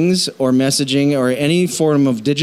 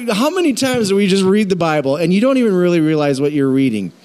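usual speaking voice

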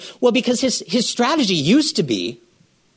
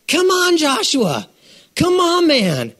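high, loud come on; exasperated; 10 semitones higher, 3 dB louder, slower than usual. Real, not acted. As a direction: push him to snap out of it. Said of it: "exasperated preacher".

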